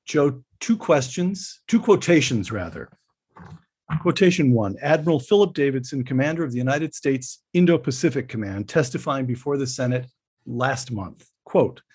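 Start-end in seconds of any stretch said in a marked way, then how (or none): none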